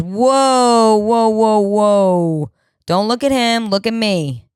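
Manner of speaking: high, loud auctioneer calling the room; auctioneer's call. Retold or imitated. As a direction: rattle off the bid like an auctioneer holding the crowd. Auctioneer pushing the bid.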